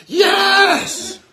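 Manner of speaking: very high, loud screamed cheer; long, piercing yell of triumph; full scream. Retold or imitated. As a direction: scream it out like an ecstatic coach. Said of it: "and I screamed".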